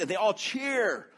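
ordinary talk, nothing marked

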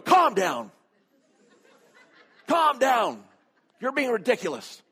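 high, loud wifely reprimand, easing at the end; exasperated scolding. Retold or imitated. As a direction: imitate a wife ordering her husband to settle. Wife scolds husband who's freaking out.

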